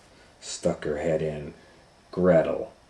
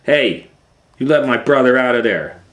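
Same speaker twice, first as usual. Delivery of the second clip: high, loud, fast demand; furious girl shouting; angry shout; 7 semitones higher, 12 dB louder than usual. Acted. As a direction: yell at the witch to free her brother. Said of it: "said Gretel".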